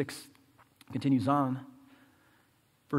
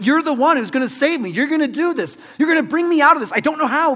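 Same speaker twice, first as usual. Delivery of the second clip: high, loud, pleading preacher's voice; acted desperate prayer, urgent and raised; impassioned preaching; 11 semitones higher, 9 dB louder than usual. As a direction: plead with God at full force. Preaches in a desperate believer's words.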